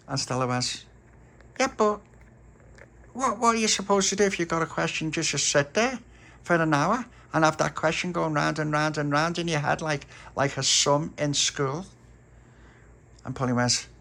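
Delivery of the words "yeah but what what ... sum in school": high, pleading defence; indignant girl. A narrator's reading. Stella defending her question to the others. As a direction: argue your case, high and earnest.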